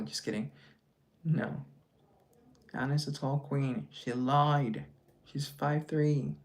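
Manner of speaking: ordinary talk, nothing marked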